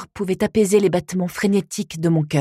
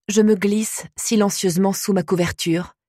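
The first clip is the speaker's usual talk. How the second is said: usual speaking voice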